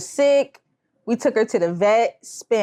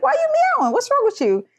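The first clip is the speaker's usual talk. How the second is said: much higher, baffled squeaky questions; confused by the meowing, voice way up; faster than usual. Retold or imitated. Confused sibling questioning her sister, high baffled squeak. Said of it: "And I was like".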